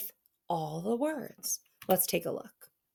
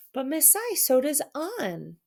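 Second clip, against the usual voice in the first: a raised pupil voice calling out; child asking; 7 semitones higher than usual. Pretend. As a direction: pretend student chiming in. Student voice interrupting teacher.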